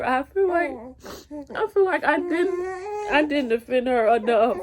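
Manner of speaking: tearful, pitch rising as she cracks; holding back tears over her daughter; voice breaking. Real, not acted. Mother telling viewers she didn't defend her daughter, voice cracking.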